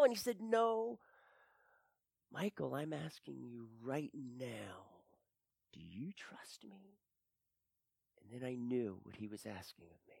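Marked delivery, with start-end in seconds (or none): 2.3-7.0 much softer, whispered question. Retold or imitated. Yeshua quietly asks Michael again, whispering. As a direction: press gently and quietly. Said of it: "he spoke very softly into my heart".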